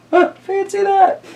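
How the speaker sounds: put-on high, amazed old lady; squeaky old lady. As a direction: marvel like a tiny old woman. Old lady marvels in story.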